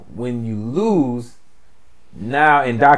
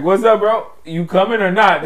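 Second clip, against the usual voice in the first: put-on Jamaican accent; 5 semitones higher than usual. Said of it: "Jamaican African accent".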